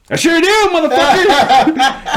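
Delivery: high, slow, laughing mock shout; mocking yell. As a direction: crow it back at the teacher, laughing. Mock-shouting back at teacher.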